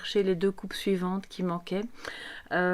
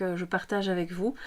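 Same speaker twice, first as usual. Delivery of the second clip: ordinary talk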